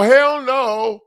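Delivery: loud, high hell no; flat refusal, voice punched up; retold defiant outburst that fades back down; indignant refusal. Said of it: "I go".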